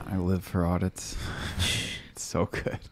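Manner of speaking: whispered sarcastic "so good". Real, not acted. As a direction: say it dripping with irony. Sarcastic about audits.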